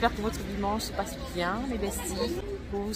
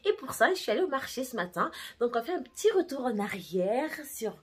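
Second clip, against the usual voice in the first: ordinary delivery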